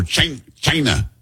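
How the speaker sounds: "China" in a soft, drawn-out mock presidential voice; president's "China". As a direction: lightly mimic the president saying "China". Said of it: "How, how does the president say it".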